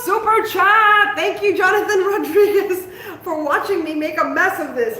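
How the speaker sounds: high, loud, laughing thanks; excited thanks. Real, not acted. Host thanks a viewer for his super chat, excited. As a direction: thank him with a burst of joy.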